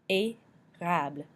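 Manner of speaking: "Érable" slow, split after the "É"; slow teaching demo of "Érable"; syllable by syllable. Shown as a demonstration. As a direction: sound out "Érable" with a gap after "ay". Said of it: "makes the A sound".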